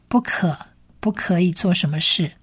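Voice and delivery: ordinary talk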